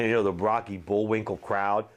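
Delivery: ordinary talk, nothing marked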